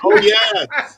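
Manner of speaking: mock insistence. Witch insisting on her dress.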